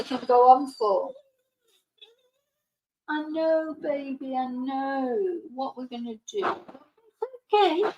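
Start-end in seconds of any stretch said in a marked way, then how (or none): 3.3-7.9 soothing. Real, not acted. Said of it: "I just keep saying to him".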